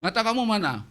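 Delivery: high, loud combative taunt; angry challenge. Retold or imitated. Driver challenging the preacher in traffic, angry. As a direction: throw the question back at him. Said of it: "Dia lebih galak lagi".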